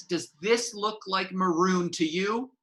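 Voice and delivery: slow, pointed sneer; slow scolding. Acted. Stage manager scolding a guard about his lanyard, slow. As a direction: dangle the question over them.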